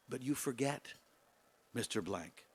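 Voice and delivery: a candidate's quiet, rueful admission; concluding sadly. Acted. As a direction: let the words sink, resigned and hushed. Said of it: "he concluded sadly".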